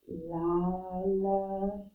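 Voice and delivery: L'allemand pulled out very slowly; very slow model of L'allemand; slow demo. Shown as a demonstration. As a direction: drag L'allemand out for the class. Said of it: "I want to switch colors".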